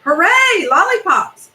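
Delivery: high, loud cheer of many voices; shouting chorus. A narrator's reading. Crocodiles cheering for lollipops, loud and high. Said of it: "A whole chorus of voices shouted".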